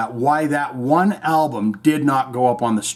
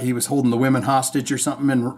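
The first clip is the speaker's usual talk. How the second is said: usual speaking voice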